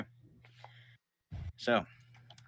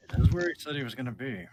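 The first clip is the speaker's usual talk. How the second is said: put-on older man; 6 semitones higher, 9 dB louder, faster than usual. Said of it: "this older man go".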